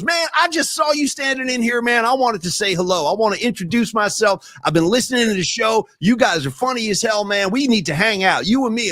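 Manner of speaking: a hint of Dwight's accent, friendly; lightly imitating a friendly Dwight; Dwight's friendly greeting. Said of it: "And he goes".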